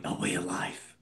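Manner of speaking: conspiratorial whisper in the ear. Acted. Monk confides his creed to the hero in a whisper. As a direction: draw him close and whisper the secret. Hand raised, solemn creed.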